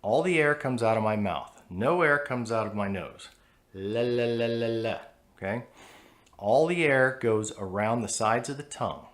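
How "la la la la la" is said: la la la with a dark L; dark L demo. Shown as a demonstration. Teacher demonstrating the dark L. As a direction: sing the la with the back of the tongue up. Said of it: "it is a dark L".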